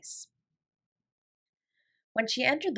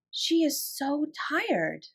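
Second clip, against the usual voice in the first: slower still, soft and high, stress on "She"; tender thought; 6 semitones higher, 3 dB quieter, much slower than usual. A narrator's reading. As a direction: let "She is so tired" sink gently. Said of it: "she thought".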